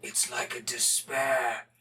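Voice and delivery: deep, slow menacing wolf voice; wolf guy voice. Pretend. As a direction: growl it like a dark wolf creature. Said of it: "that wolf guy's voice".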